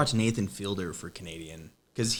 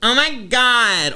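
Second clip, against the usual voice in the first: put-on high, drawn-out Californian American accent; slow, loud caricature of a Californian American; hammed-up American gush, pitched way up; 11 semitones higher, 6 dB louder, much slower than usual. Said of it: "America has three accents".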